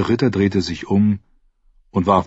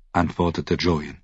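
ordinary talk

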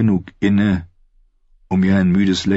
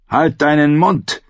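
snapping; 8 semitones higher, 3 dB louder than usual. Acted. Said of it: "fauchte der Kapitän ihn an".

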